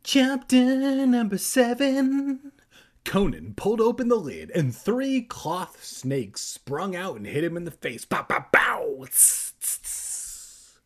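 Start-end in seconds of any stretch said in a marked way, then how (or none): 0.0-2.5 very high, loud, slow announcer call; hammed-up chapter announcement. Mock grand chapter announcement. 8.0-10.8 imitated impact noises. Imitates snakes springing out.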